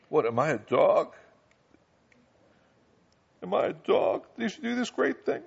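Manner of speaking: an insulted "am I a dog?"; indignant Hazael. Acted. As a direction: play the stung messenger denying it. Hazael protests the prophecy.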